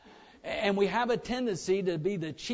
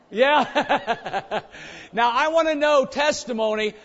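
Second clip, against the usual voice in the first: ordinary talk, nothing marked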